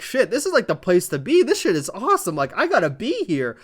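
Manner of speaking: hyped, like you have to be there; excited fan. Retold or imitated. Fans watching at home hyped up, pitch jumping. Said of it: "it makes people watching at home like".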